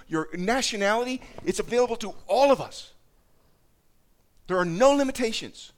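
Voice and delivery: high, loud, driving preacher's call; fervent sermon climax, pitched high and loud; preaching at full force. Acted. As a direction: build it up and preach it out.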